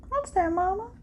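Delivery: high, hushed, coaxing; soft coaxing. Real, not acted. Reader softly coaxing the cards for an answer. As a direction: coax it softly.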